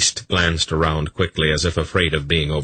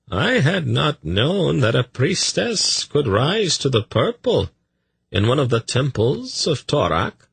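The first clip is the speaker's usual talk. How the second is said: smooth courtier Tzadi; 9 semitones higher than usual. Acted. Tzadi remarking to the priest on a priestess, high and measured. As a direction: lift the pitch high and stretch the words.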